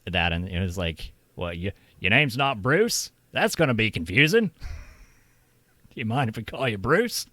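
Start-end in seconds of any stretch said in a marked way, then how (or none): 1.4-7.3 hammed-up Australian accent, pitched higher and louder; shitty Australian accent. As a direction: play a Monty Python Aussie Bruce in a deliberately bad Australian accent. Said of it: "It's my shitty Australian accent".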